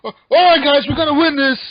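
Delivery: mock pissed-off shout, high and loud; rally the team in an exasperated yell; Hook. Imitating gaming buddy rallying distracted teammates, mock pissed-off. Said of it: "Hook gets all pissed at us".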